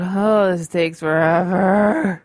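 loud, drawn-out exasperated whine; mock impatient groan. Host waiting for a slow computer to load, mock exasperated groan. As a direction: groan it out slowly, hamming up the impatience.

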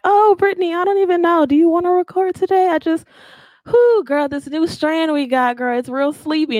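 high, put-on whiny voice, dragging; mock sleepy whining. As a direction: play yourself as a tired, sleepy whiner. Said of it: "'Cause I'm always like".

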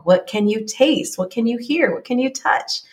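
ordinary delivery

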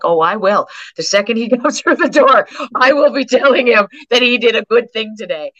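high, loud, laughing through the joy; imitating the mom bursting with thanks, a laugh in the middle; grateful excited mom. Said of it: "She's like".

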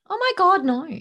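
high, loud mock-horrified gasp of a reaction; mock horror. Real, not acted. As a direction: recoil at the wording in playful disgust. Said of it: "reacting to weak copy".

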